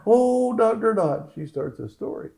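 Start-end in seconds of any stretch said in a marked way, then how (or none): 0.1-1.3 high, put-on patient's voice; acted phone outpouring, high; imitated gushing patient. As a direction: pitch it way up as the emotional patient.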